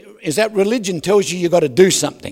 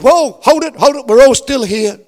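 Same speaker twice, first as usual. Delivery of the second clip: Paul shouts louder and higher to stop him; urgent call to stop; 6 semitones higher, 8 dB louder than usual. Acted. Paul calling out to stop the jailer from killing himself, loud and urgent. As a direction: throw out a hand and yell whoa.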